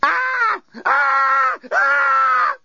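very high shrieks in bursts; resume screaming at high pitch; primal scream. A narrator's reading. Lucy carrying on screaming after a pause, primal scream. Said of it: "continued Lucy after a short pause".

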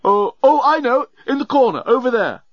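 high, excited realisation; sudden recollection. A narrator's reading. Old genius remembering the corner, excited outburst.

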